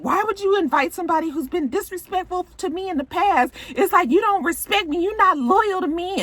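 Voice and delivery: high-pitched mock complaint; hammed-up upset protest; imitated upset wife. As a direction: act out an aggrieved wife confronting him. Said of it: "So she's all upset".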